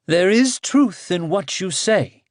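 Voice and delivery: grudging admission. Acted. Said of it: "Bagaz admitted".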